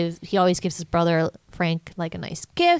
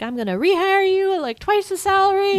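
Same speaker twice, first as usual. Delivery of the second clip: high, hammed-up giddy promise; mock giddy joy; 13 semitones higher than usual. Retelling Scrooge rehiring the fired guy, giddy mock joy. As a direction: gush like a changed man.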